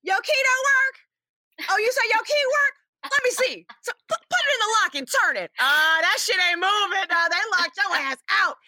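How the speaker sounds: high, loud street voice mocking Donnie.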